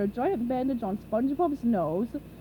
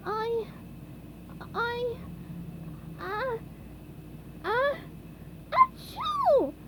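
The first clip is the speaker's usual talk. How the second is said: building sneeze; 12 semitones higher, much slower than usual. A narrator's reading.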